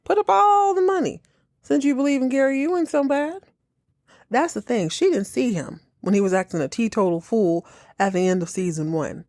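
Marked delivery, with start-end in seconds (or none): 0.0-3.1 exasperated yell. Real, not acted. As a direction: yell it at the screen. Shouting at Abby onscreen.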